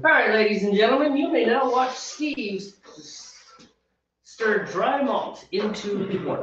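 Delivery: high-pitched mock show announcer. Brewer announces his partner stirring malt to the viewers, mock announcer. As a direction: present a humble chore like a grand act.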